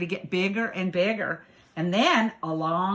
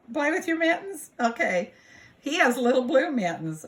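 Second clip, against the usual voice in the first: plain reading voice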